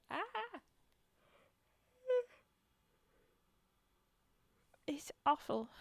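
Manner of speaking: talking through a yawn; words stretched by a yawn. Real, not acted. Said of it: "I run out of oxygen here".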